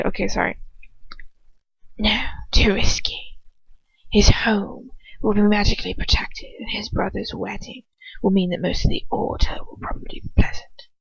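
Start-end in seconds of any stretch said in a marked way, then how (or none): 2.0-10.9 cold hissing whisper of a dark lord; Voldemort whisper. Acted. Voldemort deciding who to send, in a cold whisper.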